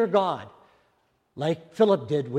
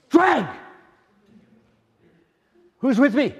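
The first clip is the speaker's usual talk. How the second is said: very high, loud joyful cry; ecstatic shout; 12 semitones higher, 9 dB louder than usual. Shown as a demonstration. Preacher acting out hearing God clearly, ecstatic shout. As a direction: burst out as the Lord comes through. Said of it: "it comes in".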